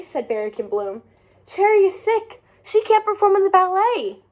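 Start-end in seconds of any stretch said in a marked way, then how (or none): 1.5-4.2 urgent. Acted. Urgent phone call to Strawberry.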